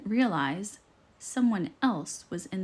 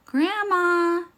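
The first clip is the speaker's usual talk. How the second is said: high, louder call into the house; 10 semitones higher, 6 dB louder than usual. Acted. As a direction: call for Grandma from the door. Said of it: "she called".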